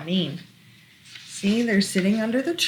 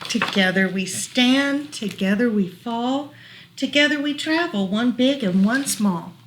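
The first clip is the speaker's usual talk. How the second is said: lilting rhyming read-aloud; reading to children; 2 semitones higher, 3 dB louder than usual. Acted. Parent reading a rhyming book to child, gentle lilt. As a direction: read the rhyme gently to a toddler.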